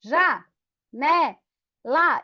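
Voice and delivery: "Já, né, lá" split by clear pauses; syllable by syllable. Shown as a demonstration. Teacher clapping out janela for the class, syllable by syllable. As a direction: clap out "Já, né, lá" one beat at a time. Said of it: "Na carteira".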